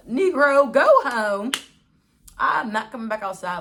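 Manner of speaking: loud, high exasperated retort; telling him off. Retold or imitated. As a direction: shoo him home, fed up.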